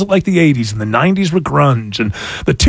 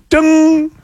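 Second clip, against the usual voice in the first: 딩 하는 효과음; ding sound effect; 10 semitones higher than usual. Pretend. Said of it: "name that song in one note".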